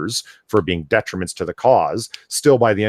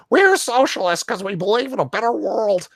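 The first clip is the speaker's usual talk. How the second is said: high-pitched put-on activist voice; mock an earnest larper in a squeaky high pitch; neat larper voice; 11 semitones higher than usual. Host mocking an article he can't read normally, high larper voice. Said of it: "the neat larper voice".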